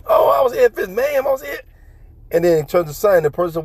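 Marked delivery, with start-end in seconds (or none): 0.1-1.6 much higher, indignant put-on mom voice; high indignant mom mimicry; high mock complaining mom. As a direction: squeak out a huffy mom's complaint.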